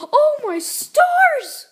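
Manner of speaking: loud, high, slowly drawn-out shock; shocked exclamation. Pretend. Mario reacts to the bird attack, shocked exclamation. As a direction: stretch it out in big play-acted dismay.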